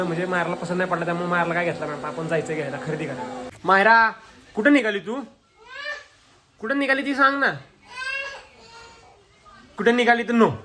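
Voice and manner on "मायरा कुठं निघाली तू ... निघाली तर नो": calling out. Real, not acted. Uncle calling after Myra as she heads off, loud calling.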